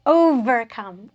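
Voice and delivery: "Overcome" slowly, leaning on a buzzing V; slow pronunciation model of "Overcome", strong V; strong V sound. Shown as a demonstration. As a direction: stretch out "Overcome" with a firm V. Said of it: "pronounce it with a strong V sound".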